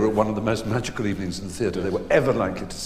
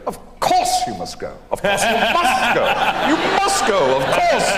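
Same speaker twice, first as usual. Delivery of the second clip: rising to a high, loud mocking sing-song; mock Olivier cruelly aping his own words; Olivier mimicking; 14 semitones higher, 6 dB louder than usual. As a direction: begins hushed, ends loud and shrill-high mockery. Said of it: "he hears Olivier's voice ... going".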